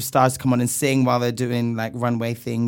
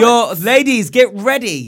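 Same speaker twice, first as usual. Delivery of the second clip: excited announcement; 8 semitones higher, 8 dB louder, slower than usual. Acted. Hyping a suggestive message.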